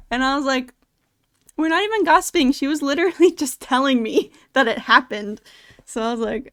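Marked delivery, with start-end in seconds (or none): 1.6-5.4 retold protest, higher and louder; indignant protest. Retelling own reply to the scolding manager, indignant protest. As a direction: protest your innocence, pitched up at first.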